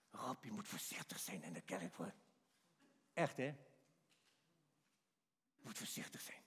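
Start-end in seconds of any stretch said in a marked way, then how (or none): 0.1-2.1 hushed whisper of a warning; secret warning. Retold or imitated. Churchgoer warning Rob in a low whisper. 5.6-6.4 hushed whisper of a warning; secret warning. Retold or imitated. Churchgoer warning Rob in a low whisper.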